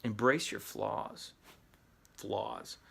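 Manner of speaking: ordinary talk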